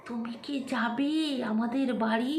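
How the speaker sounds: slow, hushed imitation of a deaf villager; imitating soft talker. Deaf villager inviting someone home, softly imitated. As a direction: say it very softly and drawn out. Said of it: "আস্তে আস্তে কথা বলতো".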